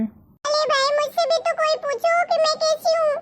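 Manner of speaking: sweet little granddaughter voice; little girl voice. Acted. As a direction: pitch it up like a small girl greeting her nanu. Child wants to be asked too.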